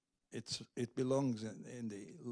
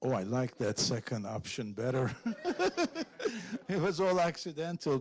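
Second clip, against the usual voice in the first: laughing as she answers; amused laugh over the words; 4 semitones higher, 3 dB louder than usual. Real, not acted. Laughs at audience suggestion.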